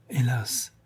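breathy, whispered sigh of regret; soft sorrowful sigh. A narrator's reading. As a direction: let the word out on a sigh. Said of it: "soupira Fedora".

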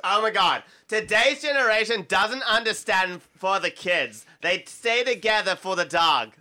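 mock Valley Girl drifting into Fran Drescher. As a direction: ham up a wobbly Valley Girl accent. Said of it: "Valley Girl".

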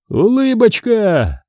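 slow, high sing-song "say cheese"; a bully commanding the photo, slow and high; mock command. A narrator's reading. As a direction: order a smile, drawn out and high. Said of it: "скомандовал Микки".

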